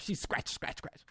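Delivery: whispered scratch noises; pen scratching sound. Retold or imitated. Aggressive note-taking in the audience, whispered scratching sounds. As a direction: imitate a pen scratching on paper. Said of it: "heard the pen scratching on the notepad".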